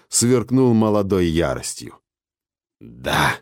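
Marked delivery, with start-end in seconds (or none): 2.8-3.3 low, croaked answer; hoarse old woman. A narrator's reading. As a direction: rasp it out like an old crone. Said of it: "прохрипел голос старухи". (хриплый голос старухи)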